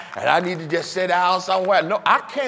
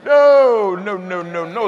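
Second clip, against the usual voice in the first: emphatic no; 3 semitones higher, 7 dB louder, much slower than usual. Real, not acted. Emphatic denial.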